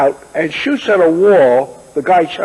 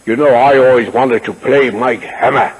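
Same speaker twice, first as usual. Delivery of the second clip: lower, deep-voiced actor impression; Sir Cedric Hardwicke; 6 semitones lower than usual. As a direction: put on a big, beautiful deep voice. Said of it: "The big, beautiful, deep voice".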